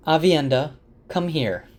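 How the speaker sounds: lightly voiced elder summoning someone over; mild summons. Bear summons Aviendha in retelling.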